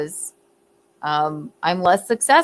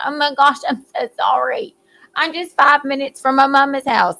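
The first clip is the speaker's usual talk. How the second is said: higher-pitched Southern apology; put-on sweet-talking Southern accent; 5 semitones higher than usual. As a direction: plead your way out in a Southern accent. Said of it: "you put your Southern accent on".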